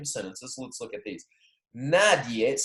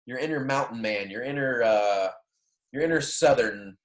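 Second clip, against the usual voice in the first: ordinary talk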